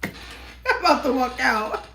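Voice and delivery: slow, high, laughing through the words; amused threat to leave. Real, not acted. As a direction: giggle it out in disbelief. Laughing at repeated message.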